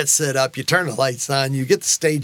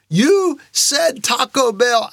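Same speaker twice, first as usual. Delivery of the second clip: high, slow imploring cry; calling out; 7 semitones higher, much slower than usual. Retold or imitated. Believer calls out to his Father, raised plea. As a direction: stretch it out like a call heavenward. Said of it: "When I called out to a father".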